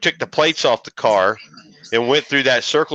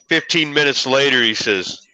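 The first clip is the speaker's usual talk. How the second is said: usual speaking voice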